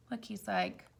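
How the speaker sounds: ordinary delivery, nothing marked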